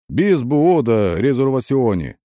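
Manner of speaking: loud, drawn-out cheeky answer; brazen reply. A narrator's reading. Fake Turk answers frightened soldier, brazenly. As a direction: answer brazenly in mock Turkish. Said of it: "нагло щерясь ответил я".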